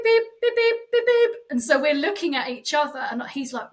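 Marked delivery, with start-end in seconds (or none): 0.0-1.3 high, loud, clipped beeps like a watch alarm; imitated watch beep. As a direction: mimic the alarm going off. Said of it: "his alarm starts going beep".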